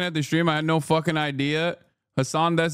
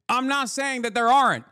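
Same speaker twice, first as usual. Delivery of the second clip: normal talking voice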